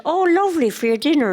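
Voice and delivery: high, fast and bright, brushing it off; retelling her cheery reply to her husband; mock cheery reply. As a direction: chirp it back breezily. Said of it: "and I said".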